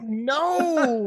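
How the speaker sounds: high, loud shout of dismay. Real, not acted. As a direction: cry out in embarrassment. Flubbed word, cries out.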